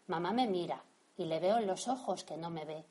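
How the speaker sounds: usual speaking voice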